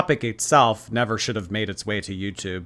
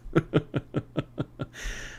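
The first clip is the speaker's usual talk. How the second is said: soft, breathless run of laughter; laughing off the outburst; real laugh; 3 semitones higher, 9 dB quieter than usual. Real, not acted. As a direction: let a long quiet laugh spill out. Laughs after her outburst.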